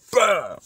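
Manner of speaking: loud, high imitated impact; explosive mock hit; punch sound.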